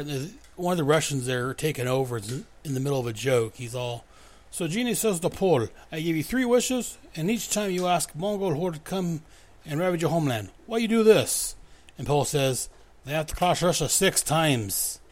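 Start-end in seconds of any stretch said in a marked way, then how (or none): 4.5-15.0 put-on cheesy Russian accent; bad Russian accent. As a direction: tell the joke like a Russian soldier in a poor Russian accent. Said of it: "my horrendous Russian accent".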